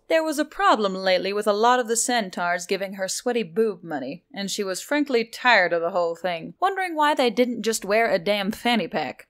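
ordinary delivery

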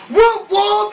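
high, drawn-out whooping; stretched, high-pitched hype call; catchphrase whoop. Shown as a demonstration. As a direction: whoop it out like a crowd chant. Demonstrating his shouted catchphrase.

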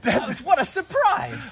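slow, sarcastic what a surprise; mock astonishment, drawn out; fake surprise.

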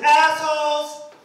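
high, stretched-out heckle; drawn-out call. Retold or imitated. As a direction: call it out long across the street. Retelling his call across street.

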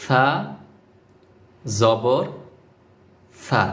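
সা with the tongue tip touching the upper front teeth, then জবর; careful pronunciation. Shown as a demonstration. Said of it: "তাহলে এভাবে পড়তে হবে".

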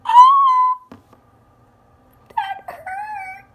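very high mock yelp; pretend pain cry. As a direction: yelp as if hit.

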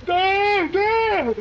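very high, loud shrieks; shrieking. Real, not acted. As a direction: squeal in terror. Pointing out monsters in panic.

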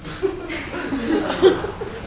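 a high, quiet, fond laugh; soft laugh. Real, not acted. Fond dad laughing at his newborn daughter's fussing. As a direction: laugh softly at the memory. Laugh after baby story.

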